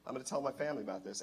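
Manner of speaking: soft, subdued quoted reply; retold calm acceptance. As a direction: speak quietly, the anger gone. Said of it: "he calmed down".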